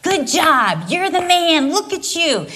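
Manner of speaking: put-on bright high cheering for a little boy; imitating an adoring parent. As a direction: cheer him on like a proud parent. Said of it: "cheering for us".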